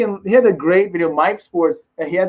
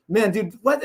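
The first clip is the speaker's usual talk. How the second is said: ordinary delivery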